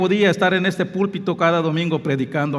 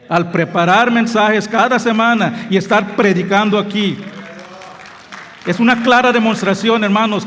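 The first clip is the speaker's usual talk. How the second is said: louder, higher testimony from the pulpit; preaching at full force; 4 semitones higher, 4 dB louder than usual. Acted. Pastor testifying to the brothers, fervent preaching. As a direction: build it up like a fiery preacher.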